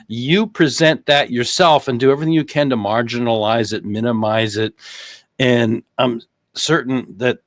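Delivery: ordinary talk, nothing marked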